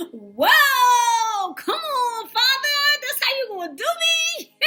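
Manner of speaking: high, loud, whiny disbelief; mock outrage. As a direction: squeal it like you can't believe he just did that to you. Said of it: "And I was like".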